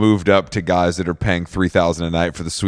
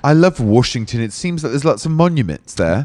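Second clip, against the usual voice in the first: put-on posh British accent, slightly raised pitch; mock posh British; 4 semitones higher than usual. Co-host joining in as British tourist, mock posh British accent. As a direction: play an enthusiastic upper-class Brit. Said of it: "these posh motherfuckers".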